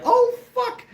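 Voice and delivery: loud, very high mock panic; panicked goose. Voicing the goose startled by the dog, mock panic. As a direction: squawk it like an alarmed goose. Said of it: "That geese was like".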